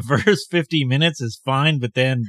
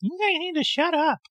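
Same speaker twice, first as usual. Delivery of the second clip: high squeaky voice for the Pomeranian; voicing the dog. Owner voices her dog Dottie, grumpy and high. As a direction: speak as a put-upon little dog, much higher. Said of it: "She just rolled over and was like".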